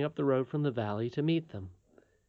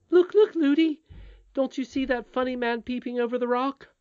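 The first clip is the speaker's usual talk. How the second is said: put-on high child's voice, loud call easing into a question; excited princess; 11 semitones higher, 5 dB louder than usual. A narrator's reading. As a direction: point it out as an eager little princess. Princess points out strange man.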